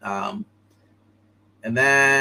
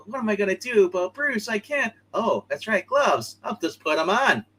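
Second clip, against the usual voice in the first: lightly voiced higher sidekick; higher character voice; 8 semitones higher than usual. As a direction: pitch it up as the sidekick. Robin voiced in retelling.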